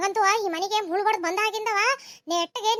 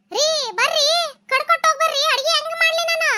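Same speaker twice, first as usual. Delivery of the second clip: higher, raised-voice nagging call; calling out crossly; 5 semitones higher than usual. Acted. Wife demands husband chop firewood first, calling out crossly. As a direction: call after him in exasperation.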